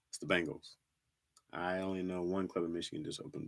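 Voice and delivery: normal talking voice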